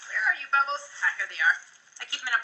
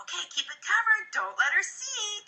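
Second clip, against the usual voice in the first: playful coaxing; 9 semitones higher, 4 dB quieter than usual. Retold or imitated. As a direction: tease the kid along gently. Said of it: "be like".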